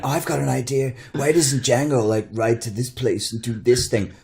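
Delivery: high, silly voice of a scriptwriter; mocking voice. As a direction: play a giddy writer with a bright idea. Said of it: "somebody went".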